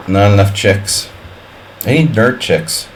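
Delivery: ordinary talk, nothing marked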